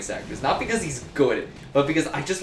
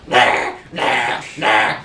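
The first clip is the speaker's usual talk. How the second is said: louder, lightly voiced mock nah; hammed-up bad president impression; horrible impression; 2 semitones lower, 6 dB louder, much slower than usual. Shown as a demonstration. Showing a friend a deliberately horrible impression, hammed-up. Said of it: "horrible impression of James Adams".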